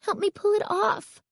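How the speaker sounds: very high, desperate urging; high-pitched impatient plea. A narrator's reading. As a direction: press a friend urgently for help. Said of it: "she urged impatiently".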